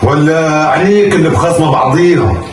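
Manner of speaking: usual speaking voice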